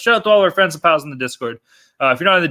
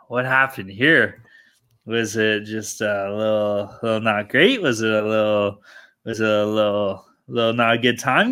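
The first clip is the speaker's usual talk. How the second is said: slow, lower, mock-innocent lament over the losses; mock dismay; 6 semitones lower, slower than usual. Host teasing co-host about bad picks, slow mock-sad. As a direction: dawdle through it like a sheepish kid.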